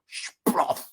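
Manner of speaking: breathy mimicked splat of a fall; splat noise. Storyteller voicing Andreuccio's fall, breathy splat. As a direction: make the thud of landing in muck. Man falls into the latrine.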